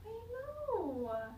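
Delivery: usual speaking voice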